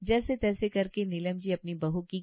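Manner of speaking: ordinary delivery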